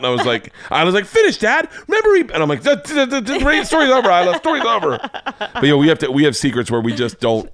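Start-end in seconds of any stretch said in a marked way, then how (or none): 1.1-2.2 put-on high-pitched kid urging dad on; imitated insistent little girl; eager child. Child urging dad to finish story. 2.6-5.0 high, stammering flustered dad; hammed-up flustered cutoff; mock panic. Flustered dad cutting story short.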